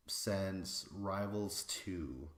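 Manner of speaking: normal talking voice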